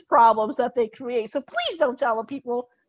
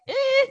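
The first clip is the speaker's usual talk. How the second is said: skeptical eh; 14 semitones higher than usual. Retold or imitated. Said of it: "lawyers could be like".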